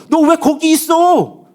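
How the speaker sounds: high, loud, long-drawn question; exclaimed reproach. Acted. Preacher as God repeating the question to Elijah, loud reproach. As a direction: cry it out slowly, every word stretched.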